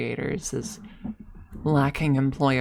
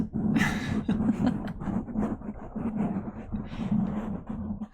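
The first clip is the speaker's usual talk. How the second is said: long, breathy fit of laughter; 6 semitones higher, 5 dB quieter than usual. Real, not acted. Worker role-play breaks down, helpless laughing. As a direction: crack up at your own joke.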